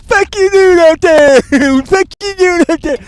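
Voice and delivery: high, loud mock of the trailer line; high mocking parody; mocking imitation. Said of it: "quoting trailer line".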